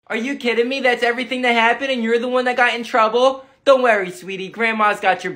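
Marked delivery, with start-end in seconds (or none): none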